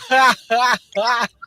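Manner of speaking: high, loud cackling laugh; helpless high-pitched guffaw; loud laughter. Real, not acted. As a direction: burst out laughing at your own remark. Cracks up at own remark.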